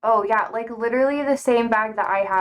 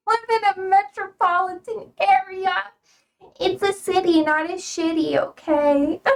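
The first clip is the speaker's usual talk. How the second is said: higher, indignant comeback with a grin; mock offended; 9 semitones higher than usual. Streamer sassing a chatter, mock offended. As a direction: play it huffy and offended.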